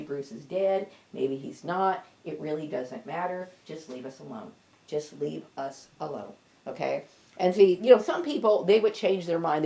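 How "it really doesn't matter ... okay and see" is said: ordinary talk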